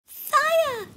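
very high, loud shout; alarmed cry. Acted. Warbler raises the fire alarm, high loud cry. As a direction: scream the warning to everyone. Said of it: "cried Danny".

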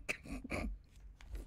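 unvoiced exasperated laugh; soft exasperated chuckle; airy breathy laugh. Real, not acted. Laugh after outburst.

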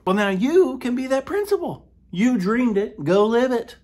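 hammed-up pep talk; mock inspirational hype. Comic host hyping teachers to become principal.

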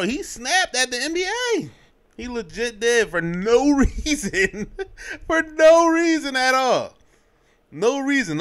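high, loud disbelief, almost laughing; can't believe he snapped for no reason; incredulous. Real, not acted. Vlogger ranting to viewers, high incredulous disbelief.

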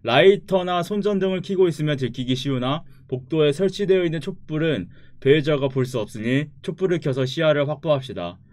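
loud, announcer-like read-out; tutorial read. Acted. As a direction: read the game tips like an announcer. Reads the game tutorial aloud.

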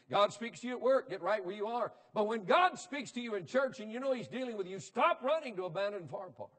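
loud, high-pitched pulpit preaching; fiery revival sermon delivery; full-force preaching. Acted. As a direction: preach it hard to the congregation.

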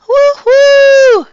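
high, loud train-whistle whoop; whooping cry. A narrator's reading. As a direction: hoot like a kitten playing trains. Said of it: "cried Burley".

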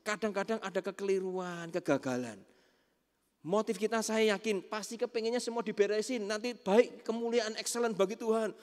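high-pitched, emphatic preaching; preacher lifting his pitch high for emphasis; fervent preaching. Real, not acted.

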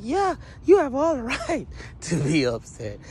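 high, loud sympathetic outburst; emphatic agreement. Retold or imitated. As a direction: agree hard, breaking into a laugh. Acted emphatic sympathy with the man.

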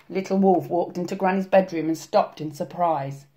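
usual speaking voice

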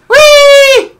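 very high, loud squeal; high-pitched playful cry; gleeful whee. Pretend. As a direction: squeal like you're on a slide. Character leaps in the game.

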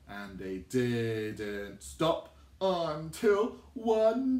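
slow, drawn-out suspense; slow build. Shown as a demonstration. Teacher building suspense before the pea pod pops. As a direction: stretch it out before the pop.

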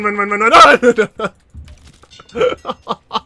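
loud, slow panicked run chant; rising panic. Real, not acted. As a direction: shout run as the chase closes in. Fleeing, voice builds.

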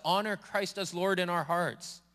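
ordinary talk

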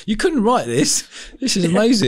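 amused amazement. Real, not acted. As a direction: say it through a laugh, astonished. Amused astonishment at guest's story.

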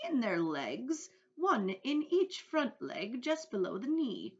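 slow, high, relishing reveal; emphatic old grasshopper. A narrator's reading. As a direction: spell it out with grand relish. Grasshopper reveals odd fact.

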